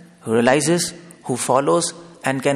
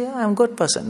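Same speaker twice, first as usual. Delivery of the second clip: smug passerby; 9 semitones higher than usual. Retold or imitated. Man in street answers.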